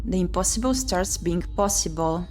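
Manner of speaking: normal talking voice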